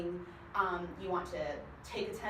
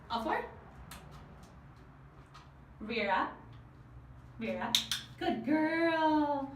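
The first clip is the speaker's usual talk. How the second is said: high sing-song dog praise; 7 semitones higher, slower than usual. Real, not acted. Trainer coaxing her dog Chloe onto the stool, high excited praise. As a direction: cheer the dog on in a bright pet voice.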